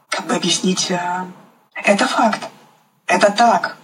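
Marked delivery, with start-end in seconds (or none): none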